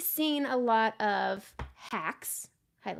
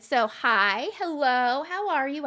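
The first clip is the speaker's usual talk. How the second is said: louder, higher, bouncy hello; signature intro; 5 semitones higher, 8 dB louder, slower than usual. Real, not acted. Host kicking off the video with her usual greeting, louder and higher. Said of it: "I start every one of my videos that way".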